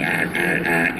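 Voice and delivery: low, gruff giant growl; a lightly low monster rumble; Bowser growl. Shown as a demonstration. Showing Bowser as a giant, lightly low growl. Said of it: "see Bowser be a giant".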